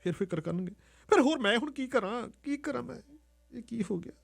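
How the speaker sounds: high, hushed and lost; a scared girl asking herself what to do; girl alone in the storeroom, lost and tearful; distraught girl. Acted. Dimpl alone, crying, lost.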